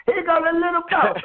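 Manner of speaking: high, happy call, imitating his friends; mock excited greeting. Friends seeing him arrive, high excited mock greeting. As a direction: sing it out like friends spotting you.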